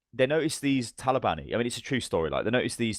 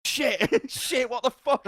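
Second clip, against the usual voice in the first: high-pitched panicked realisation, played for laughs; mock panic; 14 semitones higher, much slower than usual. Rescuer realising he's in a minefield, mock panic. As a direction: imitate sudden panic, voice shooting up.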